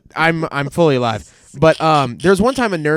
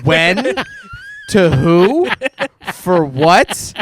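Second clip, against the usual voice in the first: louder, incredulous mock questions; mock baffled questions; 3 semitones higher, 5 dB louder, much slower than usual. A soldier mocking a pointless radio order, incredulous.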